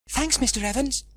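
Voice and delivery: put-on children's voice. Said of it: "said the children".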